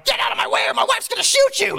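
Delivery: high-pitched frantic holler; panicked yell. Retold or imitated. Dad honking through traffic for wife in labor, frantic yell.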